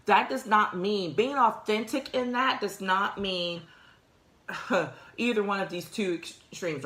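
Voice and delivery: usual speaking voice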